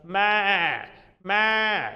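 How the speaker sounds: drawn-out "maa" like a sheep; long open-vowelled sheep's "maa"; sheep bleat. Shown as a demonstration. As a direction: bleat it out for the class. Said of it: "like the sheep does".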